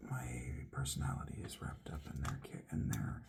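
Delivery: low, soft whisper. Real, not acted. Confessing his personality to the viewers in a whisper. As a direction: mutter it under your breath. Muttering aside while searching.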